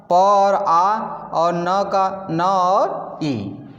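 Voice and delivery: "प और आ और न का न और ई" split into sounds, very slow; slow sounding out. Shown as a demonstration. Teacher breaking पानी into sounds for students, slow. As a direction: spell the sounds apart one by one. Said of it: "देखिए पा का".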